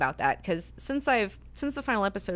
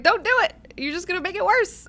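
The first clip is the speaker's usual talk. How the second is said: much higher, louder exasperated warning; mock Alan Watts; 13 semitones higher, 7 dB louder than usual. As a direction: squeal the advice like a frantic guru. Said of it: "Alan Watts is like".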